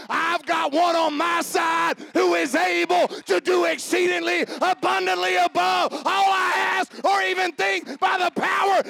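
loud, high, rising battle-cry preaching; preachy. Acted. Pastor rallying the congregation to war on hell, preaching hard. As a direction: whip the church up for war. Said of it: "Oh, I feel preachy now".

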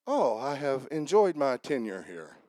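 low, soft, mock-polite golfer; prim understatement. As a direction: play it dry and overly proper after a terrible shot. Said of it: "do you say".

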